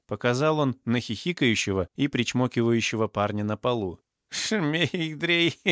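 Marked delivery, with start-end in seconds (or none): 4.3-5.7 high-pitched, doped babble with a giggle; dazed giggly muttering. A narrator's reading. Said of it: "бормотал он, прикрыв глаза". (одурманенное бормотание с хихиканьем)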